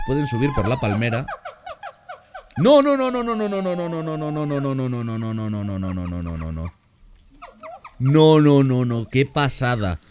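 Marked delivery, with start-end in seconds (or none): 2.6-6.8 a long run of stunned no's, fading; ecstatic disbelief. Real, not acted. Vlogger watching apes swing across the zoo, ecstatic no's fading out. 8.0-10.0 louder, higher burst, then slowing in awe; amazed. Real, not acted. Vlogger amazed at the apes, loud awed outburst.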